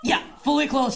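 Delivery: ordinary talk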